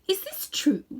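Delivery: high, demanding question; sharp queen question. Acted. Queen demands the truth from Diggory, high and sharp. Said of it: "said the Queen".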